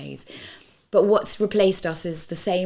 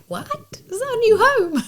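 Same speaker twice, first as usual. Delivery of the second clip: high, loud, incredulous squeak; mock disbelief; 7 dB louder than usual. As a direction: throw it out in shocked disbelief. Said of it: "we were like".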